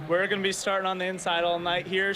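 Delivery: ordinary talk, nothing marked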